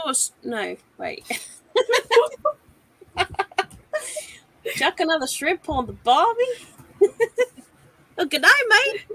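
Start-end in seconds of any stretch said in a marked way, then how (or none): 4.8-9.1 high, loud mock Australian; Australian accent. Shown as a demonstration. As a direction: ham up a cheery Aussie. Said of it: "can I do Australian?".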